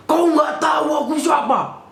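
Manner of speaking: high-pitched shouted imitation of rage; mock furious shout. Angry officer pulling rank on a questioner, imitated furious shout. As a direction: yell it like an outraged officer. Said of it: "marah besar dia".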